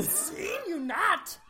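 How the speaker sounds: high, slow little sage's voice; mock the wise alien, drawing out each word; Yoda. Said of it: "we have to speak in Yoda".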